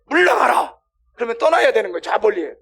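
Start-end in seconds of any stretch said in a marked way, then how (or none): 0.1-0.8 loud, high shouted command; stern rebuke. Acted. Preacher acts out Jesus rebuking the obstacle, stern rebuke. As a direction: drive it away with a shout. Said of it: "얘를 꾸짖는 거야".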